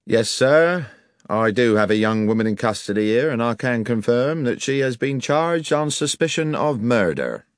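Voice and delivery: officious police sergeant. Acted. Village sergeant confirming an arrest to visitors, officious. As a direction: state the charge with pompous formality.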